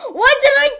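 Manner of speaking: high, loud mock scolding yell; mock shout at pet. Yelling at a misbehaving game animal, mock angry.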